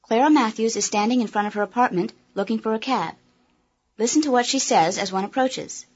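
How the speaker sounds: usual speaking voice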